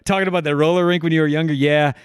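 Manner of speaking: high, forced morning-show chatter; stiff, awkward DJ small talk; awkward radio banter. Retold or imitated. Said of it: "it'd be like".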